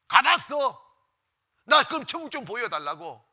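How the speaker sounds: loud, taunting interrogation; mock jeering. Communist demands the pastor show him heaven, loudly jeering. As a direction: imitate a jeering captor, pressing hard. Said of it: "막 조롱하면서".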